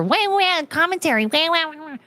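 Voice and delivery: high-pitched mock whining; mocking whine. Streamer mimicking a commenter upset about commentary, mocking whine. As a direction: imitate a complainer in a high crybaby voice.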